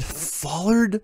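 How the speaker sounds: high incredulous "Follered?"; incredulous echo. Real, not acted. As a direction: repeat the odd word in disbelief. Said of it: "F O L L E R D?".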